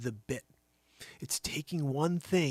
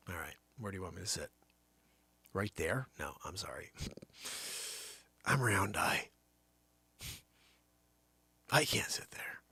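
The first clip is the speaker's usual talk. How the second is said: hushed whisper; 7 semitones lower, 6 dB quieter than usual. Acted. Acting out being seated, whispering.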